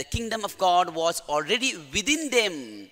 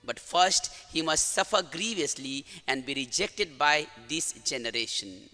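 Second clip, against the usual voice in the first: solemn, measured gospel proclamation; gospel reading; 3 semitones lower, 4 dB quieter than usual. Acted. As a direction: read the gospel with reverence. Priest reads the gospel.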